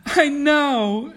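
very high, loud exclamation; acted merry shriek; amused outburst. A narrator's reading. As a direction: cry it high, on the edge of laughing. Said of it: "she broke into a laugh".

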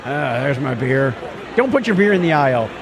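ordinary delivery

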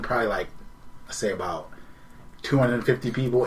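ordinary delivery

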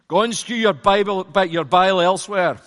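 loud mock Scottish accent, scornful. Preacher voices the Scottish comment with loud mock Scottish accent. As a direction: bellow it like an angry Scottish commenter. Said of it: "We need a Scottish accent for it".

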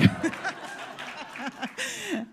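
bright real laugh. Real, not acted. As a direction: break into a laugh at the joke. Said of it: "And that is my talk".

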